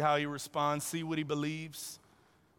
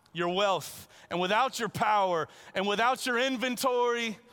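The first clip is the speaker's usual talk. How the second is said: rising, louder, pressing question; Jesus challenging; 6 semitones higher, 4 dB louder than usual. Pretend. Preacher voicing Jesus pressing the rich man, rising and louder. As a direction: push him to picture losing everything.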